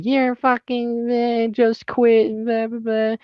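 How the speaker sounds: high, slow mimicry of a complaining boss; dismissive high-pitched imitation; mocking manager. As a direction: drag it out high, mocking his griping. Mimics manager griping on the phone.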